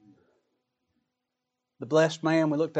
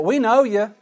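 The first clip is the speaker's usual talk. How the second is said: higher, louder insistent protest; protesting outsiders; 6 semitones higher, 5 dB louder than usual. Retold or imitated. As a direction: plead your case to the master, pushing. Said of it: "He said in that same parable".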